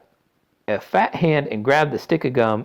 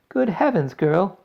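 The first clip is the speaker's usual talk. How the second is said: high, alarmed mother; mild shriek; 10 semitones higher, 5 dB louder, slower than usual. A narrator's reading. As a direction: exclaim in sudden alarm. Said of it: "shrieked Miss Beauregard suddenly".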